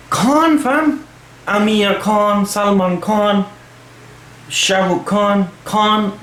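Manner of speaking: "Khan" with a throaty, guttural kh at the back of the mouth; proper Khan pronunciation. Shown as a demonstration. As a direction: say "Khan" from the throat, with the rasp of the Arabic kh. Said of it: "That's how you pronounce it".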